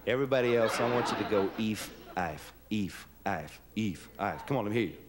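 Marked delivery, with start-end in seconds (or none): 1.6-4.5 lowest voice. Shown as a demonstration. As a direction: drop to the bottom of the voice for eef eif. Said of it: "do it in your lowest voice".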